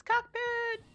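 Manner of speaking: "cockpit" yelled high; gleeful high shout of "cockpit". Pretend. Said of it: "So keep saying it".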